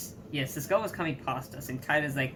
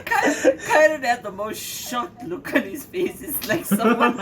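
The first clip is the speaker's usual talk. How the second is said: laughing while telling; 6 semitones higher, 6 dB louder, slower than usual. Real, not acted.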